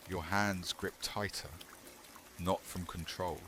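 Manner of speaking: usual speaking voice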